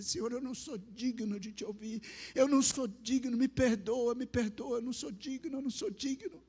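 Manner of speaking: retold desperate plea for forgiveness; breaking down. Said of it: "Aí eu desabei, eu falei". (súplica desesperada, voz aguda)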